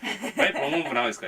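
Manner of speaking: ordinary delivery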